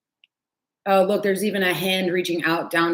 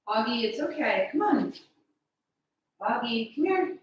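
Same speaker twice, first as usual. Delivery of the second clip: coaxing pet voice; 6 semitones higher, 5 dB quieter than usual. Real, not acted. Coaxing her frightened cat out, soft high pet voice. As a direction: coax the scared cat gently and high.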